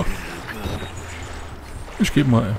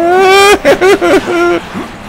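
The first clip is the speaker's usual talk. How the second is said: high nervous laugh. Real, not acted. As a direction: burst of shrill laughter. Laughing after getting caught.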